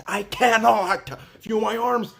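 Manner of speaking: CANNOT drawn out, high and loud; emphatic disbelief. Retold or imitated. Patient retelling numb arms, CANNOT stretched in exasperation.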